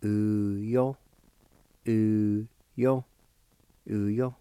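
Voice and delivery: U, yo repeated three times, each syllable distinct; Cherokee word. Shown as a demonstration. Teacher drilling U, yo for the word garbage. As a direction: repeat U, yo for the learner. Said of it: "it's pronounced like this".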